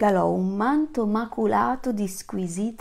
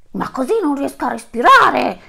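elephant complaining; 6 semitones higher, 8 dB louder than usual. Acted. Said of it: "rispose il grosso animale".

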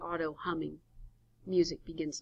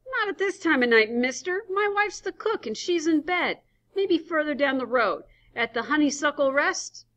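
put-on gruff old storekeeper; 10 semitones higher, 8 dB louder than usual. Sleepy roadside stand owner.

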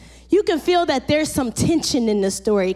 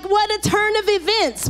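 normal talking voice

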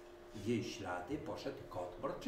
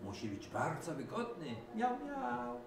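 much higher mewing miau miau; meowing cat; 11 semitones higher than usual. A narrator's reading. Cat praising the car, high meowing. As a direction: mew it like a cat. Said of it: "miałknął kot Mruczysław".

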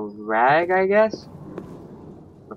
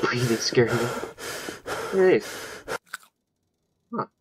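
ordinary talk, nothing marked

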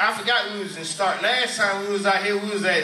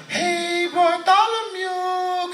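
high, drawn-out singsong call; rich mom; 13 semitones higher, much slower than usual. Acted. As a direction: trill it like a hammed-up rich mother. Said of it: "that's how rich people talk".

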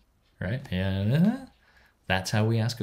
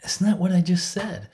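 higher, louder mock indignation; exasperated retort; 9 semitones higher, 7 dB louder than usual. Lecturer retelling his own frustrated reply to an Italian, mock outrage. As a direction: throw it back in baffled disbelief. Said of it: "And I'm like".

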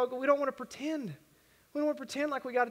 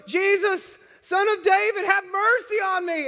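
loud, high desperate cry for mercy; yelling; 7 semitones higher, 6 dB louder than usual. Retold or imitated. Blind Bartimaeus crying out to Jesus, loud and high. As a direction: call out over the crowd at full voice. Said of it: "he just starts yelling".

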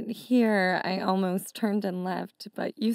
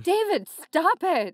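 scolding; 7 semitones higher, 6 dB louder than usual. Acted. Scolding ex's innuendo.

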